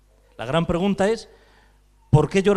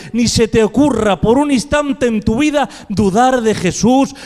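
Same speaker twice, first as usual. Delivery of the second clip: raised, emphatic sermon delivery; impassioned preaching; 4 semitones higher, 7 dB louder than usual. Real, not acted. Preacher urging the faithful never to doubt Jesus, impassioned preaching. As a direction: drive each phrase home to the congregation.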